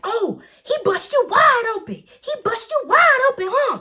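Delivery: high, loud sneering accusation; mocking mom. Mom checking daughter, high sneering yell. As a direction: taunt her as the furious mom. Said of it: "she was like".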